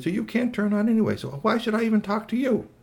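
high-pitched, scornful toy; dismissive Squeaky Toy. Acted. Toy challenging the robot boss, dismissive.

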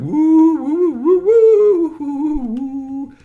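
loud, very high warbling hoots; swooping, high alien "woo" call. Acted. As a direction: hoot like an excited space creature. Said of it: "But he said".

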